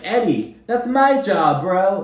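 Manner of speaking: joking Ryan. Retold or imitated. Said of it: "He's like".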